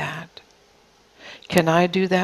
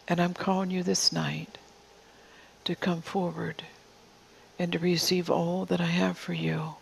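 softer, tender word spoken as the Father; soft prophetic voice; 4 dB quieter than usual. Acted. The Father inviting the congregation forward, soft and tender. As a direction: draw them close, gently, toward the altar.

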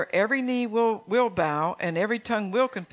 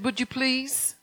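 raised, pleading ask; begging request; 5 semitones higher, slower than usual. Retold or imitated. Jesus inviting you up to third heaven, pleading. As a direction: plead the invitation gently upward. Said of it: "he more or less begged you".

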